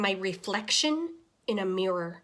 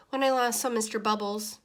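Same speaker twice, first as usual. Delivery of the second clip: ordinary delivery, nothing marked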